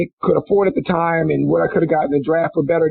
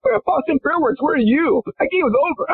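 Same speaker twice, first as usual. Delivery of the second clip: high, hammed-up yell back; mock yell; 11 semitones higher than usual. Rookies shout back from the bar, high mock yell. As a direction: shout it back like a cocky rookie, half joking. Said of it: "We're like".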